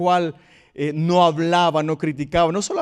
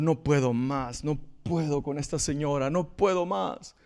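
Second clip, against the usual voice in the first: crying; 4 semitones lower, 8 dB quieter than usual. Retold or imitated. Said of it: "llegó llorando a la casa".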